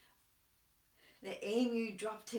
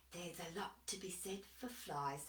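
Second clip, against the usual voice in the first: whisper; 4 semitones lower, 12 dB quieter than usual. Acted. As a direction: sly whisper. Dingo agrees slyly.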